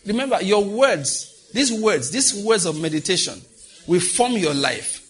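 high-pitched, emphatic exhortation; fervent preaching. Real, not acted. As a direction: press each phrase home to the congregation. Preacher exhorts congregation about their words.

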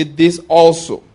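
"also" drawn out slow and loud; stress on "also". Shown as a demonstration. Preacher has everybody say the verse's key words, stressing "also". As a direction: lean hard and slowly on "also". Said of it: "my emphasis there is the word".

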